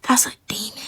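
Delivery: hushed warning whisper. Real, not acted. Vlogger whispers a warning to her viewers about demons.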